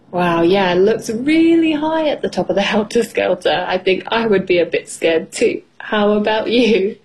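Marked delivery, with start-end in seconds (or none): none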